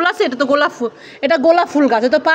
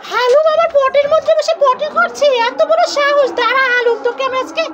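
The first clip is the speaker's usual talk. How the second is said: high squeaky mock fury; angry toy character; 9 semitones higher than usual. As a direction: play a cartoon character threatening a rival. Mother voices angry toy. (খেলনা চরিত্রের উঁচু গলায় নকল রাগ)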